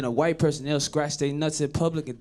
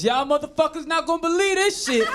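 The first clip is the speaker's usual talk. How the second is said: higher, hyped story opener; animated, pitched-up story opener; storyteller opening; 8 semitones higher than usual. Retold or imitated. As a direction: launch the tale like hot gossip.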